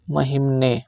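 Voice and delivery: महिम्ने with म and न joined, no vowel between; महिम्ने with mn cluster. Shown as a demonstration. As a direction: say महिम्ने as mah-im-ne with a tight mn. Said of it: "अब म के नीचे न है".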